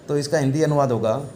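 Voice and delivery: normal talking voice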